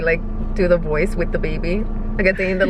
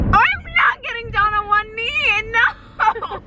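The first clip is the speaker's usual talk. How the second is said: squeaky high mock refusal; high playful protest; 13 semitones higher, slower than usual. Real, not acted. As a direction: pitch it way up, teasing.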